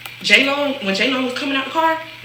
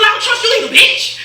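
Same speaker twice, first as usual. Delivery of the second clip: much higher, laughing mock snap; sudden retort; 10 semitones higher, 4 dB louder than usual. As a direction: blurt it out of nowhere. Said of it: "She just said out of nowhere".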